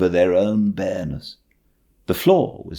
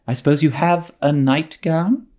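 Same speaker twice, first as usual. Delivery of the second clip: questioning Marilla; 10 semitones higher than usual. Acted. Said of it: "she questioned".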